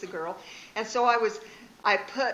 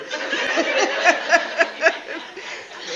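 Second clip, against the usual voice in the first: high, breathy laughter; 8 semitones higher, 9 dB louder than usual. Real, not acted. Laughing between the moral and its repeat. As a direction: crack up again.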